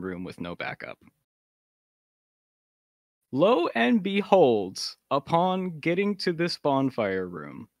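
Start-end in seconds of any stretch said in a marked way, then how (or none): 3.3-7.7 dramatic. Real, not acted. Dramatic storytelling build-up.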